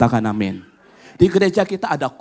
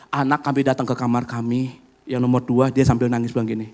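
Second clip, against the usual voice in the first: ordinary talk, nothing marked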